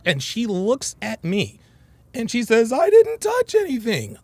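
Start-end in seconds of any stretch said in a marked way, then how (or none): 2.7-4.2 mimicked high, loud yell of protest; retold yell. Girlfriend snapping back at him over the phone, mock high yell. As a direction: imitate an indignant yell, pitched way up. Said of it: "Like, she yelled".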